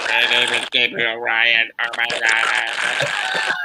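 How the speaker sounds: teasing imitation of a staticky alien. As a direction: alien voice over a bad connection. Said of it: "You sound like the alien at Area fifty-one".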